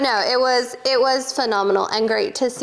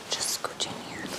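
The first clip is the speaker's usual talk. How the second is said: whispering; 15 dB quieter than usual. Real, not acted. Quiet aside while moving seat.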